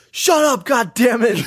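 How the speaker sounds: high, angry mock yell; mad shout. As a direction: snap it out in imitated fury. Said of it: "Yeah, he's so mad".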